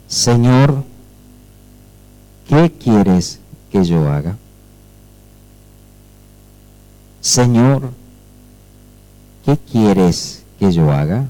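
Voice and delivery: low, slow, hushed and humbled before the Lord; trembling and fearful. Acted. Paul asking Jesus what to do, trembling and fearful. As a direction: ask it haltingly, low and in awe. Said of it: "Él, temblando y temeroso".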